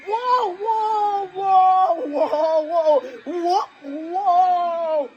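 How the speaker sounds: very high wailing whoas; high, panicky string of cries; panicked screams. Acted. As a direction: scream whoa over and over. Car runs a red light.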